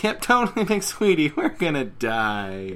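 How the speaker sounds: sarcastic Rainbow Dash. Retold or imitated. Rainbow Dash mocking Fluttershy, high sarcastic chirp. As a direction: chirp it, then drag the doom out. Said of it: "Rainbow Dash is like".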